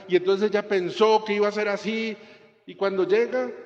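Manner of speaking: high, loud, fervent preaching. Acted. Priest preaching loudly about Mary Magdalene at the tomb. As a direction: preach it out to the whole congregation. Opening preaching at full force.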